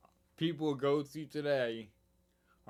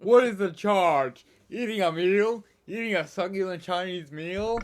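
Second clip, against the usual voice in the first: outraged arrested man; 6 semitones higher, 10 dB louder than usual. Acted. Re-enacting the dine-and-dash arrest for the co-host, loud mock outrage. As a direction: boom it out like an indignant diner under arrest. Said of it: "he was arrested in the U K".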